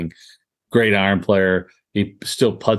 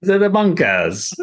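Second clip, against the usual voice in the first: a light put-on British accent, pitched up high; mock British accent; 11 semitones higher, 3 dB louder than usual. Mocking his own bad accent attempts to the co-host in a put-on British accent. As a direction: toss off a jokey British "To the bunkers". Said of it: "Sounded British".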